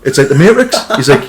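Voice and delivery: ordinary delivery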